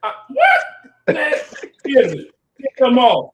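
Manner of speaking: outraged. Real, not acted. Saints fan exploding at a rival fan's jab. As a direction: blow up in disbelief, loud. Outraged reaction in heated argument.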